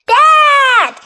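high, loud cry for Dad; acted child's yell. A narrator's reading. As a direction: shout out in pretend alarm. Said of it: "he yelled".

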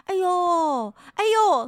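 building alarm over a bad tooth; three dismayed ohs. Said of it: "三声哎呦". (一声比一声高的哎呦)

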